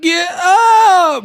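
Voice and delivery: high squealed yell, stretched out. Retold or imitated. Copying the rapper's clip right after playing it, squealing imitation. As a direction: mimic a shrieking falsetto cry. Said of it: "I'ma sound like [name]".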